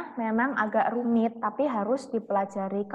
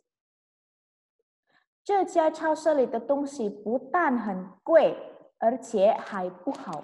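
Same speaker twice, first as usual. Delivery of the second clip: very slow, syllable by syllable; slow model reading; much slower than usual. Shown as a demonstration. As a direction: dictate it slowly to the class. Teacher reads full sentence. (membaca sangat pelan)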